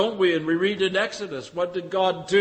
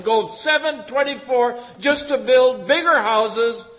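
preaching with force; 4 semitones higher, 6 dB louder than usual. Real, not acted.

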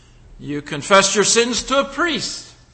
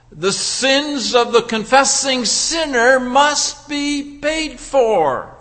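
usual speaking voice

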